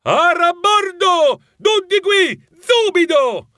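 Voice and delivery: high, drawn-out full-force shout; drill-sergeant style holler, pitched high; grandfather Torquato shriek. Acted. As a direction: bellow a slow, commanding roll call. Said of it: "Lo strillo modello nonno Torquato".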